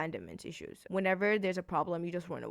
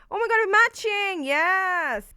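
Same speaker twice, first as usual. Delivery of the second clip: much higher and louder, overjoyed; thrilled squeal; 11 semitones higher, 11 dB louder, slower than usual. Real, not acted. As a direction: squeal with delight at the match. Delighted the books match.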